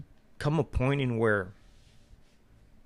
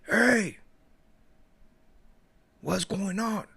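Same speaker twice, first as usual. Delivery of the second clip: calling out; 9 semitones higher, much slower than usual. Retold or imitated. Neighbor calling out.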